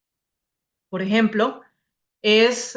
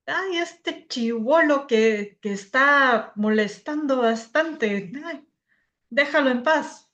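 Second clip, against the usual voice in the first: put-on slow, drawn-out Peruvian accent; imitated Peruvian Spanish; 4 semitones higher, slower than usual. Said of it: "hablar como habla el peruano".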